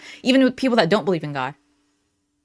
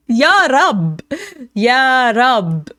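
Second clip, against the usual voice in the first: louder, higher mock Israeli accent; 5 semitones higher, 5 dB louder, much slower than usual. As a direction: belt Ya Rab in a hammy Israeli accent. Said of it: "an Israeli accent".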